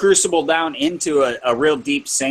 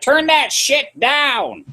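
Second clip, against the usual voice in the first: higher, slow mock complaint; annoyed wife; 12 semitones higher, much slower than usual. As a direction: drawl it like a fed-up wife. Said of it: "She's like".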